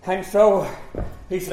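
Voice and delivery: normal talking voice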